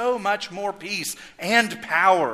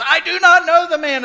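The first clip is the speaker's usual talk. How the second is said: very high, loud shout; furious denial; 12 semitones higher, 13 dB louder than usual. Retold or imitated. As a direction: hurl the denial at the accusers. Peter's third denial, cursing.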